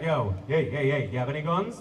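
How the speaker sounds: a low Jamaican bouncer checking guests. Retold or imitated. Party bouncer asks about guns in a low Jamaican accent. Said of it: "he's like".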